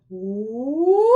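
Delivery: loud pinched ooh, space collapsed; collapsed soft palate. Shown as a demonstration. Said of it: "what people wanna do is".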